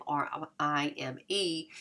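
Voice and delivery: slow letter-by-letter spelling R I M E; spelled out. Shown as a demonstration. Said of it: "that rhyme".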